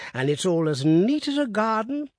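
put-on high, slow quavering old voice; old Woodifield quavering about the cemetery. A narrator's reading. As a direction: let the old voice tremble upward. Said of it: "quavered old Woodifield".

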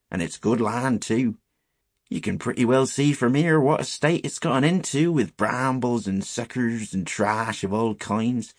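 insistent rustic storyteller voice; old countryman laying down local knowledge; earnest old shepherd. Acted. Shepherd telling boys about the shunned field, earnest.

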